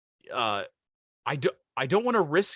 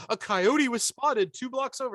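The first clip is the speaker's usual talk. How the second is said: mock alarm; 10 semitones higher than usual. Mocking neighborhood app alerts.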